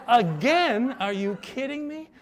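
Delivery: shrieked, loud and high "Again?"; incredulous. Retold or imitated. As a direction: recoil at the very idea, voice flying up. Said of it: "I started laughing hysterically".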